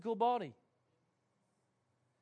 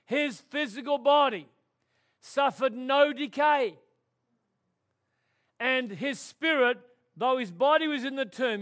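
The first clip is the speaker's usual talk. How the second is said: louder, higher, fired-up preaching; full-force preaching; 4 semitones higher, 6 dB louder than usual. Acted. Pastor proclaiming resurrection to the church, full-force preaching. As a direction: preach it out with rising fervour to the congregation.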